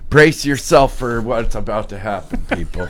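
mock ominous. Cohost teasing dramatic warning.

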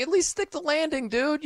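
playful nudge like a buddy; mock pep talk to Cam. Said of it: "You're like".

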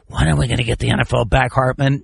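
fast, low imitation of a caller; mock caller. Host mimicking callers nobody ever was, mock pleading. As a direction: rattle it off as a pestering caller.

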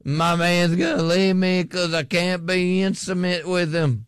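mock her complaint, drawn out and pleading; imitating a whining woman. Retelling a TV show, mimicking the worried wife. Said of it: "she's like".

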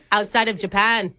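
broad mock-Aussie; put-on Australian accent. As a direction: confess in hammed-up Australian. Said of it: "Are you from Australia".

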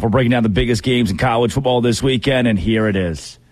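ordinary talk, nothing marked

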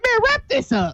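high-pitched mock call to wrap up; lightly acted interviewer cutting it short. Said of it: "Joe was like".

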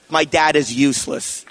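ordinary talk, nothing marked

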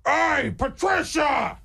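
high slow shouted call of a name; old man calling. Retold or imitated. Old man at his door calls his dog, high slow shout. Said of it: "he goes".

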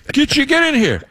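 mock a colleague calling out in a high voice; New England coworker. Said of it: "one of my New England coworkers".

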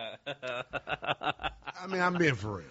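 laughing through the words; can't stop laughing while insisting. Real, not acted. Host cracking up while insisting he's for real.

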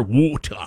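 "water" as "woh-tuh", no r at the end; British "water". Shown as a demonstration. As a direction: show the British "water" with rounded first vowel. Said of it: "You know how British people say water?".

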